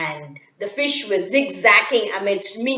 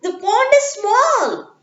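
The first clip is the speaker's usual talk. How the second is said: put-on much higher, louder girl telling the buffaloes off; girl scolding; 12 semitones higher, 5 dB louder than usual. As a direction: shoo them away, slowing at the end. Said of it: "she told them".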